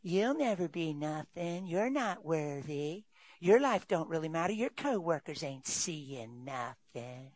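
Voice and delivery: slow, soft, sly imp voice; taunting little imp. Acted. Preacher plays the imp taunting a believer, slow and soft. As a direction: lean in and sneer each put-down softly. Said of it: "that little imp coming up there".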